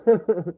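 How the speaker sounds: short loud laugh at his own joke; burst of laughter. Real, not acted.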